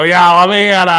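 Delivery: brusque local order. Retold or imitated. Host imitating a New England customer, blunt and loud. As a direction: bark it like a regular who skips hello. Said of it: "You go".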